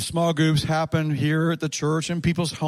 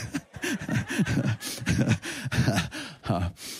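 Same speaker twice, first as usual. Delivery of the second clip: quiet chuckle; 4 semitones lower, 6 dB quieter than usual. Real, not acted. Laughs about grandfather's word.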